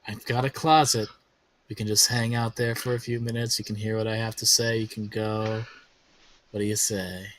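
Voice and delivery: very low, soft, insistent sleaze; Prospero, persistent schemer. Acted. Schemer luring companions to the back room, low murmur. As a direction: press them in a low murmur. Game master plays Prospero.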